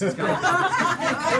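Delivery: high, loud, slow laughing quote; mimicking him mid-laugh; laughing imitation. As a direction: squeal it through laughter.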